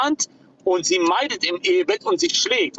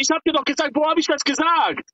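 high, heated protest; heated shouting; 10 semitones higher than usual. Real, not acted. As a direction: push back hard and high. Heated accusation in argument.

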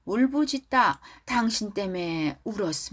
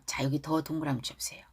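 whispered aside; 6 semitones lower, 8 dB quieter, much faster than usual. Real, not acted.

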